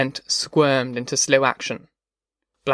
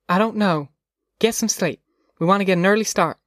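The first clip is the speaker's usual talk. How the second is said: lightly voiced mother, pitched higher; slightly raised pitch, settling a child to sleep; mother at bedtime; 6 semitones higher than usual. A narrator's reading. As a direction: gently put off a child's question.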